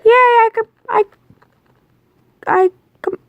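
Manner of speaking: very high, loud happy squeak; excited squeal. Acted. Claimed dog cheering for its new owner, squealing. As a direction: squeal with joy like a puppy.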